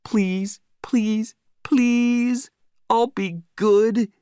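high, drawn-out begging; pleading dog. A narrator's reading. Dog begging his owner to be let off leash, high pleading. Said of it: "I could read his thoughts".

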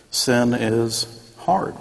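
sin is hard said slowly, each word weighed; slow emphasis. Real, not acted. Preacher to congregation, slow heavy emphasis. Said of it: "I'm just saying".

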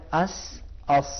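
heavy, thick "اس" drawn out; thick sad sound. Shown as a demonstration. Teacher drilling the heavy ص for the student. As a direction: thicken the س in "اس" into a full ص. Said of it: "اسی سین کو موٹا کریں".

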